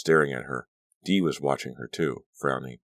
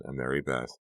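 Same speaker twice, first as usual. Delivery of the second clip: plain reading voice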